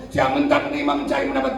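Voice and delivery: normal talking voice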